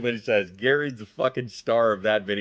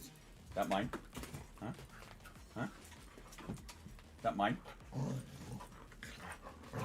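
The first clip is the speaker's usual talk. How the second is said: whisper; 15 dB quieter than usual. Pretend. Teasing dog about its toy.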